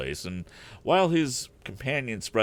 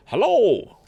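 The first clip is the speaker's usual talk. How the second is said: loud exclamation; 10 dB louder than usual. A narrator's reading. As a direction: call out a loud, high hello. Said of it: "exclaimed our hero".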